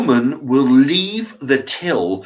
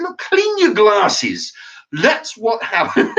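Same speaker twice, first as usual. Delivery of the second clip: sarcastic retort; 11 semitones higher, 4 dB louder, slower than usual. Retold or imitated. Retold retort to the till clerk.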